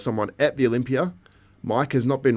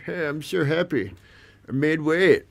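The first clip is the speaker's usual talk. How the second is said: higher-pitched mock Ramon accent; put-on Ramon accent; 6 semitones higher, slower than usual. Streamer imitating Ramon happy at making weight, mock accent. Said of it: "why I did that accent".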